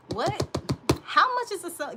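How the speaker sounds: can't believe the price; stunned by a viewer's question; disbelief. Real, not acted. Reacting in disbelief to chat.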